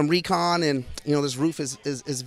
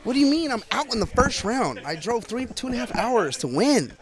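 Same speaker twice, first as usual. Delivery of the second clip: mock outrage; 6 semitones higher, 3 dB louder than usual. Real, not acted. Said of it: "I feel terrible".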